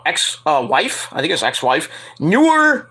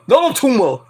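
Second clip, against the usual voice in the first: Schwarzenegger voice; 6 semitones higher than usual. Retold or imitated. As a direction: punch it like Arnold. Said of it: "as Nick would say".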